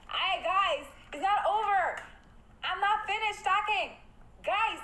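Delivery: high, loud protest at chat; loud high-pitched indignation; indignant call to chat. Real, not acted. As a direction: stop them leaving, raise your voice high. Streamer scolding chat for ending.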